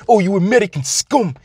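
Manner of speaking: put-on high, foreign-accented terrorist sneer; a hammed-up foreign accent spitting at an American; mock foreign villain accent, pitched high; vague foreign villain accent. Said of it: "don't ask me where this, um, accent is from".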